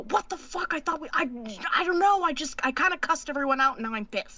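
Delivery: whisper-shout in frustration; stage whisper. Acted. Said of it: "whisper yell to Ace".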